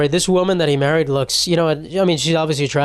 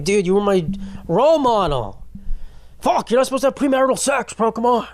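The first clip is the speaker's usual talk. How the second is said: angry fan; 6 semitones higher than usual. Retold or imitated. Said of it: "Do you think the fans of the team get mad".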